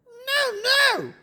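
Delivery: high-pitched parrot echo; high parrot voice, mimicking a refusal; squawking parrot.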